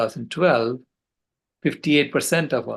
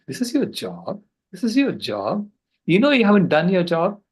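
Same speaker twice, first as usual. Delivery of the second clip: put-on indignant child; 6 semitones higher, 3 dB louder than usual. Child scolds grandpa.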